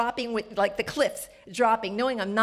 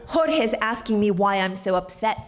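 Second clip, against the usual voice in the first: ordinary talk, nothing marked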